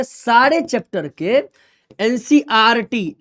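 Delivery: slow, deliberate सारे chapter के; slow emphasis. Shown as a demonstration. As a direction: drive it home word by word. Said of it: "बच्चा आपको क्या करना है".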